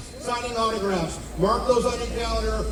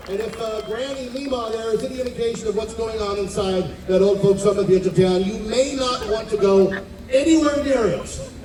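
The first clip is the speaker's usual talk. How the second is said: usual speaking voice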